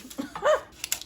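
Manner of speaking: high honking laugh, like a goose; a real laugh that honks like a goose. Real, not acted. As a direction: let the laugh honk up high. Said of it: "I sound like a goose".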